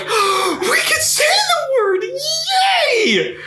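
gasp, then very high gleeful squeal; hammed-up, drawn-out giddy delight ending in a cheer; mock excitement. Host mocks comedians thrilled at permission, squealing excitedly. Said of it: "you could just see how excited".

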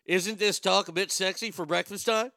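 hammed-up Canadian accent with tacked-on "eh"; loud mock Canadian voice. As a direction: play a cartoon Canadian, projecting.

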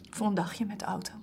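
soft breathy whispered wish. Acted. Emily softly asks Joris to take her along, whispering. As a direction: breathe it, barely voiced. Intimate longing plea to Joris.

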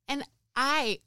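real tears close, pushing through the words; choked up. Real, not acted. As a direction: voice catching, rising high with emotion. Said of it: "I'm gonna get choked up".

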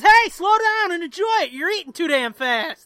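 high, loud mock scolding; hammed-up punchline yell; joke character yelling. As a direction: shout it down to your partner. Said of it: "the guy at the head yells".

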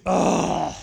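exasperated "oh" groan; frustrated groan. Retold or imitated. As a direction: let out an acted groan of frustration. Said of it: "that means frustration".

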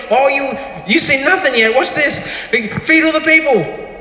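high, eager challenge; playful excited Jesus voice. Retold or imitated. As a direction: show them what's coming with glee.

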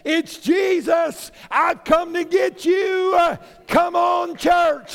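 high, loud rallying call; Jesus. Acted. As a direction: call out triumphantly to gather everyone, drawing the words out. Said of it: "he's going to say".

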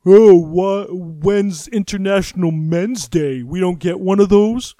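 whiny, indignant mock voice, slow on the middle line; mocking an aggrieved man demanding his own day; prick voice. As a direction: sneer it like an aggrieved bro complaining. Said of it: "that's the natural prick voice".

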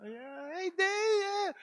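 very high, stretched cowboy drawl; cowboy type. Retold or imitated. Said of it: "kinda cowboy".

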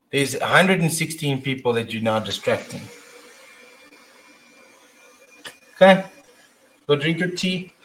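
talking to her son. Real, not acted. Mother talks to her son mid-stream.

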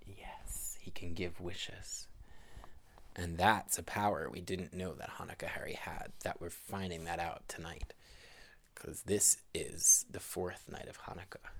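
whispered secret for the listener; hushed storytelling. A narrator's reading. Parent answering child's question, soft whisper.

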